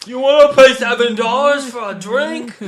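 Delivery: high, loud pushy barista pitch; mock barista. Friends joking about Starbucks, a loud high mock barista. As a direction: needle the customer like a Starbucks clerk.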